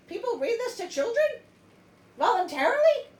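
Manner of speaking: loud, high disbelief; can't believe people read this; incredulous. Real, not acted. Outraged at dark fairy tale.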